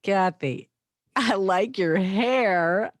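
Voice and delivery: "I like your hair" loud and stretched out, rising at the end; different emphasis. Shown as a demonstration. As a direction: punch out "I like your hair" slowly and loudly, lifting "hair". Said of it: "total different emphasis on the same words".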